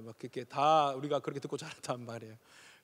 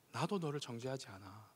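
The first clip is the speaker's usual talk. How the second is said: lower, much softer and gentle; tender Jesus; 4 semitones lower, 10 dB quieter than usual. Shown as a demonstration. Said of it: "어떻게 예수님 말씀하셨겠어요?".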